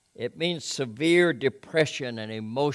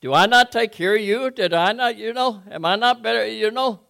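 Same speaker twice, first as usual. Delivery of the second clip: higher, louder, self-pitying husband; Elkanah pleading; 10 semitones higher, 10 dB louder than usual. Retold or imitated. Elkanah to Hannah, higher and louder mock plea. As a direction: whine it like a hurt husband. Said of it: "Elkanah would ask".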